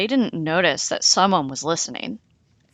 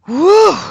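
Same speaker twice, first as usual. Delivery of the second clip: 1 relieved whew; 13 semitones higher, 13 dB louder than usual. A narrator's reading. Relief after danger.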